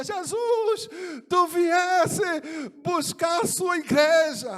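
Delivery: joyful full-voiced shout, high and drawn out; great joy. Man clinging to Jesus in a vision, joyful shout. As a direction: cry out to Jesus in overjoyed amazement. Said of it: "aí eu dizia com alegria tão grande".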